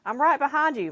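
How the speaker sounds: usual speaking voice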